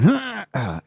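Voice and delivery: imitated high effort grunt; deliberate grunt. Aunt bending to pick up kindling, exaggerated grunting. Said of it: "bending down and grunting on purpose".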